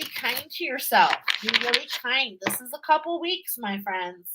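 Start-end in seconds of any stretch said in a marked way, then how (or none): none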